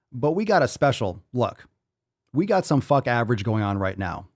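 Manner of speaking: ordinary talk, nothing marked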